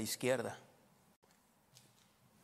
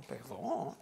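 drawn-out, questioning repeat of a word; mock-stunned question back; astonished echo; 3 semitones lower, 8 dB quieter than usual. Pastor taken aback by an apology, astonished.

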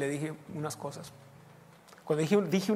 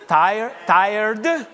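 translating with a laugh; 6 semitones higher, 9 dB louder than usual. Retold or imitated. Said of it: "que a gusto me reí".

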